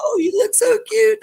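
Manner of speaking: hammed-up gushing compliment; mock gushing. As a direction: coo it like aunties fussing over a haircut. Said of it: "And they said".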